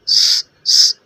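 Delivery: स hissed with no voice, sharp whistle; short unvoiced hissing स; whistling s. Shown as a demonstration. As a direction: isolate the whistle sound स. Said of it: "इसमें भी सीटी स".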